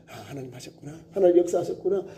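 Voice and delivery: softly, as if to yourself, awed; hushed grateful realization. Retold or imitated. Worshipper coming down from the temple, hushed in awe. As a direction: breathe it out in quiet wonder. Worshipper's inner thanks after prayer.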